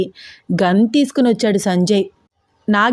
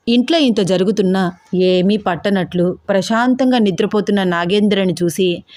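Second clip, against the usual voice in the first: ordinary delivery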